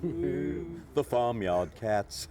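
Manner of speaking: sing-song reading. Acted. Performing the children's rhyme.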